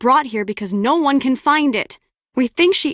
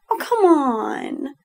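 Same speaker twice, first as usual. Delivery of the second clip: long drawn-out whine; slow, exasperated plea; pleading protest; 3 semitones higher, much slower than usual. Acted. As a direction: stretch it out, wheedling. Acted pleading after refusal.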